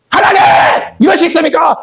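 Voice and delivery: a desperate shout heavenward; shouted protest to God. Acted. Believer crying out at God.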